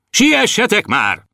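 high, drawn-out hurrying call; shouting from above. A narrator's reading. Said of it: "A fejedelem kiabált föntről". (magas, sürgető kiáltás föntről)

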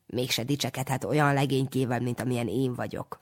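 usual speaking voice

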